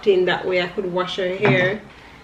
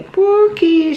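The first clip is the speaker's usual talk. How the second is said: high, loud sing-song Pookie; teasing call; 9 semitones higher, 4 dB louder than usual. Retold or imitated. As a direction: tease her with the nickname. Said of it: "We say".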